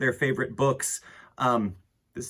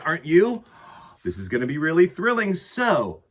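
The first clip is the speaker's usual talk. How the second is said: excited; 6 semitones higher, 6 dB louder than usual. Real, not acted. Hyping kids for the book.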